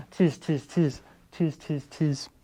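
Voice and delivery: high, soft monkey chatter. Acted. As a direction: tease him back like little monkeys. Monkeys mimic the peddler.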